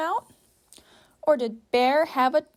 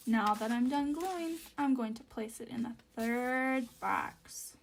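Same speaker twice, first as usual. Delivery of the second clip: quiet; 10 dB quieter than usual. Real, not acted. Soft recap while gluing.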